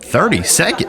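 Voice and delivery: high-pitched disbelieving question; incredulous. Real, not acted. Streamer balks at the quiz time limit, incredulous. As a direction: echo it back in startled disbelief.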